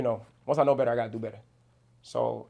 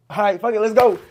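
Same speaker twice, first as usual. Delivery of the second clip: louder and higher, fired-up acceptance; like jumping at a studio invite, pumped; excited yes; 9 semitones higher, 10 dB louder than usual. Retold or imitated. Rapper retelling saying yes to a studio session, loud and pumped. Agreeing to a surprise studio call.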